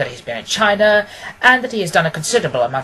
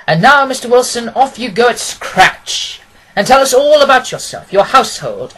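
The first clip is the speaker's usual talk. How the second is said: brisk, high, louder invitation; high-spirited; 7 semitones higher, 7 dB louder than usual. Acted. Holmes invites Wilson to tell his story, in high spirits. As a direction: cheerfully urge him to begin. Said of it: "in high spirits".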